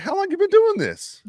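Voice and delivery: squeaky high mock disbelief; incredulous retort. Patient retelling his jab at the nurse, high incredulous mock. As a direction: hit the question way up high, half-laughing at her.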